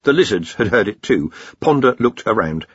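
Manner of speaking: plain reading voice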